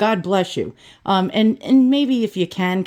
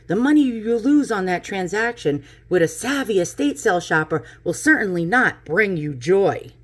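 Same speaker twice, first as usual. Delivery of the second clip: normal talking voice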